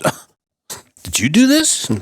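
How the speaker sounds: high, surprised question. Retold or imitated. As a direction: act startled, voice jumping up. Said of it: "Peter walks in and says".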